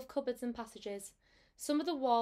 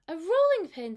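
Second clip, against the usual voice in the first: startled realisation; 6 semitones higher, 9 dB louder than usual. A narrator's reading. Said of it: "said Rigby".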